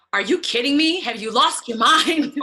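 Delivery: loud mock disbelief; incredulous manager. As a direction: imitate a manager who can't believe it. Voicing business manager's shock.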